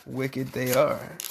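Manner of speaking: low, slow and soft; hushed echo. Acted. Parent repeating the verdict, slow, low and soft. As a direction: breathe it out like a secret.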